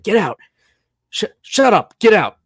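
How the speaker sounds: retold exasperated shouting; mock furious order. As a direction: imitate a man losing his temper. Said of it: "just lost his shit".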